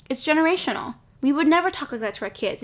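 ordinary talk, nothing marked

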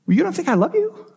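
stunned parent, voice leaping high; a parent hurt and surprised; hurt surprise. Retold or imitated. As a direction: pitch jumps up in shocked disbelief. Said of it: "your heart would sink".

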